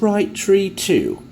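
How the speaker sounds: a hammed-up snooty English gent, drawn out; a snooty English fella points out trees in a slow mocking drawl. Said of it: "this snooty English fella".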